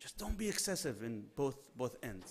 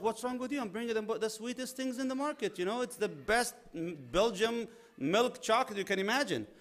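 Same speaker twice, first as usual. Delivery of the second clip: indignant husband; 7 semitones higher than usual. Retold or imitated.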